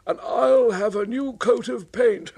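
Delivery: high, mocking scorn; scoffing Henry. Henry mocking James's chances. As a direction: sneer at the rival.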